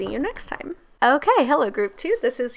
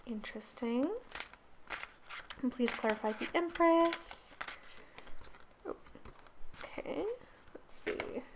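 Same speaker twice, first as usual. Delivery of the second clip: hushed; 3 semitones higher, 15 dB quieter than usual. Real, not acted. Quiet reactions while pulling cards.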